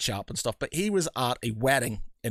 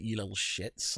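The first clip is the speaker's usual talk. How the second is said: muttered insult; 5 semitones lower, 9 dB quieter than usual. Retold or imitated. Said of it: "I was just like".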